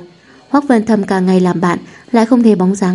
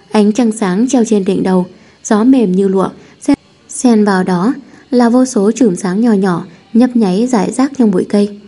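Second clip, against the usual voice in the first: ordinary delivery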